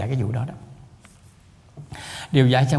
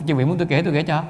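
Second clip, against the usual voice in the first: ordinary talk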